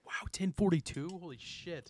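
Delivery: breathy shocked whisper; whispered surprise. Real, not acted. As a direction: gasp it in a whisper.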